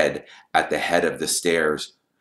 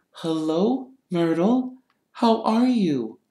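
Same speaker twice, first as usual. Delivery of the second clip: gentle greeting; 11 semitones higher, slower than usual. Acted. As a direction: greet a touchy ghost gently and high. Hermione gently greets touchy ghost.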